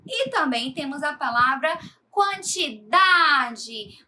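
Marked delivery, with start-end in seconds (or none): 2.1-4.0 "quantidade" slowly, DA louder and held; stress on DA. Shown as a demonstration. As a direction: stretch the DA in "quantidade". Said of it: "o dá é a sílaba tônica". ("quantidade" com o DA forte e alongado)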